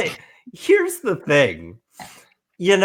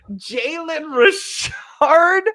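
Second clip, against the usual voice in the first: much higher, slow, cracking incredulous cry; incredulous wail; 11 semitones higher, much slower than usual. Real, not acted. Roasting John for starting Jalen Richard, higher wailing disbelief. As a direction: stretch the name out in disbelief.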